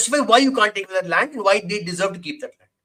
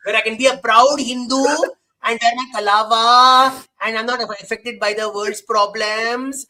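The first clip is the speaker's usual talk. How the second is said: mock South Delhi accent. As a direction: draw out the endings in a mocking South Delhi accent. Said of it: "you understand where this comes".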